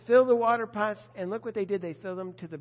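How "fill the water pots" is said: commanding. Retold or imitated. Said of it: "Jesus says".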